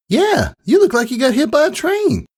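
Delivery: high, sweet filly voice teasing; teasing friend. Acted. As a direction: poke fun at her tired friend. Sweetie Belle teasing her friend.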